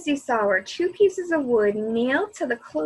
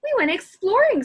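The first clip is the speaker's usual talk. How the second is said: excited; 9 semitones higher, 6 dB louder than usual. A narrator's reading. Eager kids answer Henry.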